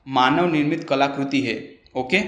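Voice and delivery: ordinary delivery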